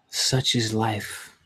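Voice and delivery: slow, sighing and resigned. Real, not acted. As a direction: let it out with a weary sigh. Resigned sigh while feeling low.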